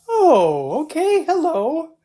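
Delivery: very high, drawn-out bemused greeting; mock-startled squeak of surprise; startled reaction. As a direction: retell it as a high-pitched startled hello. Retells startled reaction to loud sound.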